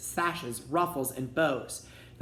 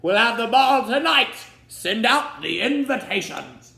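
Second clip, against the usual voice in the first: king's voice; 8 semitones higher, 9 dB louder than usual. A narrator's reading. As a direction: bellow like an impatient old king. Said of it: "the king shouted".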